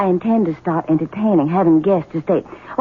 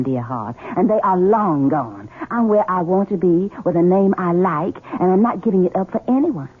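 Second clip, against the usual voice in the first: usual speaking voice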